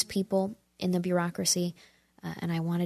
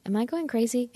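amused; 7 semitones higher, 4 dB louder than usual. Real, not acted. Laughs at her own rant.

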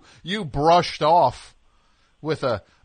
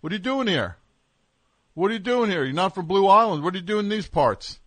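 lightly voiced suspicious cop, raised pitch; mock local cop; 5 semitones higher, 3 dB louder than usual. Host playing cop questioning outsider's license, mock suspicion. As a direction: grill the outsider like a small-town cop.

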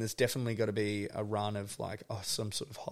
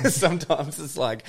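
laughing through the words; 6 semitones higher, 8 dB louder than usual. Real, not acted. Laughing while explaining the job. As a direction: breaks into a laugh mid-phrase.

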